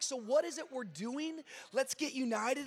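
ordinary talk

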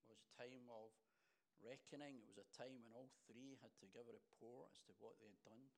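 ordinary delivery

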